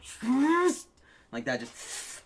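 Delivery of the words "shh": sharp shush. Retold or imitated. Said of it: "my mom was like".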